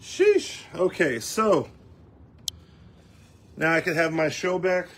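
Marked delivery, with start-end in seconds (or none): 0.0-0.6 high, loud breathless sheesh; winded exclamation after a bet's push-ups; exhausted sheesh. Real, not acted. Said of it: "That's rough".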